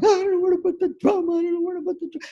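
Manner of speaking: high-pitched babbling in a mock panic; frantic panicking dad. Band dad panicking over a timpani, frantic high voice. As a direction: imitate a flustered dad, squeaky and overwrought. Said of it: "dad that was just running around out of control".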